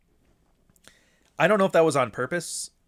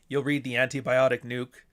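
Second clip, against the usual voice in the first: ordinary talk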